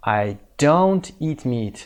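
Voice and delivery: very slow, stressing don't; slow correct form. Shown as a demonstration. Teacher modelling the negative for learners, slow. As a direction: lay out don't slowly. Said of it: "باید از کمکی استفاده بکنیم".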